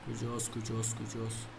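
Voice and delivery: soft, low muttering while searching; searching murmur; muttering to himself. Real, not acted. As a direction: mumble it under your breath as you look. Searching the symbol menu aloud.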